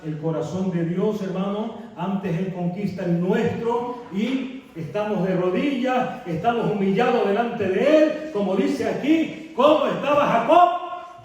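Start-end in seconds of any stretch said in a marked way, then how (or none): 2.0-11.2 preaching, building up. Acted. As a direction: build the sermon to a peak. Preaching at full force. (predicando cada vez más alto y fuerte)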